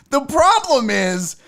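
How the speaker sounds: laughing while talking. Real, not acted. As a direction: chuckle through the words.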